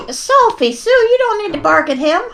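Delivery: high-pitched talking to a pet; scolding the dog. Real, not acted. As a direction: chide a barking dog in a high voice. Scolding her barking dog.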